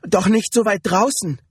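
high-pitched, anxious disbelief; frightened protest; panicked. A narrator's reading. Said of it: "sagte sie ganz panisch".

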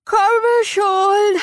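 calling out. Acted. May calls out as she leaves her bed, calling out. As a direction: sing out the words, high and long.

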